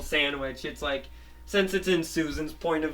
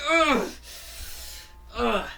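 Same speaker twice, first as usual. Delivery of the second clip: pained grunts; 9 semitones higher than usual. Retold or imitated. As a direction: grunt twice as if struck. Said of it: "She's like".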